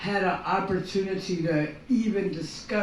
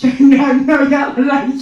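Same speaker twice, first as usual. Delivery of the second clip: laughing; 6 semitones higher, 11 dB louder than usual. Real, not acted. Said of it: "she doesn't know her accents at all".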